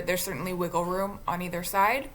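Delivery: ordinary talk, nothing marked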